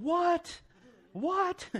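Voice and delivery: high-pitched mock-stunned yelp of disbelief; incredulous. Preacher reacting to Jesus walking on the lake, incredulous. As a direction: squeal it in comic disbelief.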